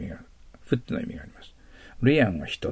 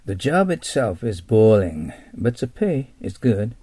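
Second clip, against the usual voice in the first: slow, louder, as if chatting to a friend; acted conversation line; 5 dB louder, much slower than usual. Shown as a demonstration. Teacher acting out small talk about a boring job. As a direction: say it like a casual complaint at work. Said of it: "っていう話をするときには".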